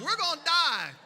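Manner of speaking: high, loud mock terror; mock panic. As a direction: wail it like doom is here. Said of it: "And you're like".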